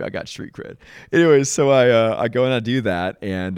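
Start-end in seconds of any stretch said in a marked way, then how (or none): 0.0-0.8 a voiceless whisper tossed off as a joke; whispered aside. Real, not acted. Joking to listeners about his community service, whispered aside. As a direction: whisper it like a secret brag.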